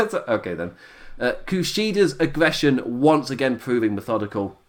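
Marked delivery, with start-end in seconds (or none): none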